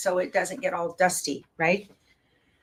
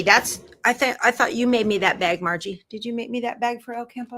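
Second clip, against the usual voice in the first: usual speaking voice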